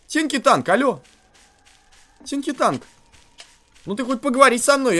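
normal talking voice

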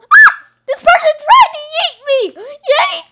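very high, loud, fast yelling; startled shout. Real, not acted. Player yelling at someone who tried to yeet him. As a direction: yell in shocked outrage.

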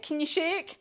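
ordinary delivery, nothing marked

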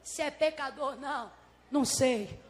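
low, quiet, unhurried answer; healed man's reply. Acted. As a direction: answer the accusers with calm certainty. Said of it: "E vira ele".

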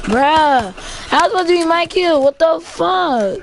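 loud, high, fast protest; angry shout about a stolen kill; outraged. Real, not acted. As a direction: yell it like the kill got stolen.

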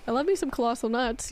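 normal talking voice

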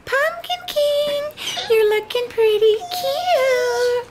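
high, slow doll voice; Sally voice. Pretend. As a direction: coo it like a sweet doll. Voicing Sally doll in play.

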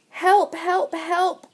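high-pitched little truck cries; acted high cries for help; crying for help. A narrator's reading. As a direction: call out urgently as a little truck. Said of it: "cried the little blue truck".

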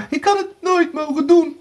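high, wailing self-reproach; tearful regret. A narrator's reading. Erika regrets going downstairs, sobbing. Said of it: "snikte Erika".

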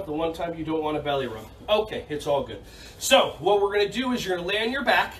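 usual speaking voice

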